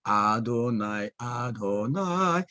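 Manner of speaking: slow, stretched 아도나이, each vowel held; drawn-out reading. Retold or imitated. Teacher showing how Israelites read the divine name aloud, drawn-out reading. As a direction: draw out 아도나이 syllable by syllable. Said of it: "와이 에이치 더블유 에이치만 나오면은".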